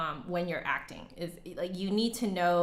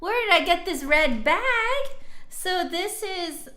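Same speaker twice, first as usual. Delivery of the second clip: surprised; 12 semitones higher, 7 dB louder than usual. Real, not acted. Sudden question about bag.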